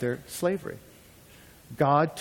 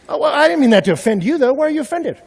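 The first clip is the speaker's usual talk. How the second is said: higher, quick falsely innocent voice; mock innocent guest; 12 semitones higher, 10 dB louder, faster than usual. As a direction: play dumb, a little too brightly. Voicing offended innocent guest.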